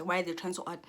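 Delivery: ordinary talk, nothing marked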